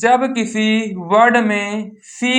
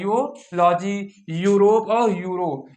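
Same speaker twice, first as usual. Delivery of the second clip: drawn out slowly; much slower than usual. Shown as a demonstration. Teacher slowly sounding out यूलॉजी, Europe and euro for students. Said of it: "क्या लिखा है?".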